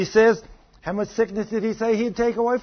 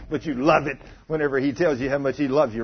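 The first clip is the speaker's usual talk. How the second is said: ordinary talk, nothing marked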